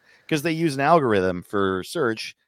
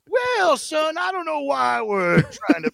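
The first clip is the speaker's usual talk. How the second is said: Southern elder voice. Pretend. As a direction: scold gently like a Southern granddad. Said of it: "Google South is like".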